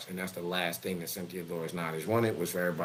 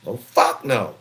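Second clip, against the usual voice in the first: louder, firm "Oh, fuck no"; loud refusal; 9 dB louder, slower than usual. Retold or imitated. Father refusing to let his daughters strip, loud refusal. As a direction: shut the idea down hard.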